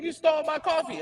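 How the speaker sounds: roommate's accusation, mimicked high and mocking. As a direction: pitch it way up to mock her. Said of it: "she was like".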